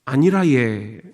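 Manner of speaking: Gyeongsang accent with a drawn-out ending; light Gyeongsang lilt, last syllable held; Gyeongsang dialect. Shown as a demonstration. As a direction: say it like a Gyeongsang local. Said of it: "경상도는".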